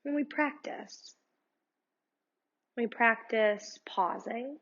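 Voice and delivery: calm, unhurried meditation guide voice; guided meditation. Acted. Yoga teacher guiding her students through meditation, calm and unhurried. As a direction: lead the listener gently through breathing.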